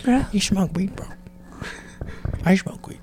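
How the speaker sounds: whispered, hushed; mock narc whisper. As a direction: whisper it like a secret. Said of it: "unvoiced whisper".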